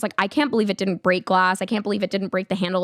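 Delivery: usual speaking voice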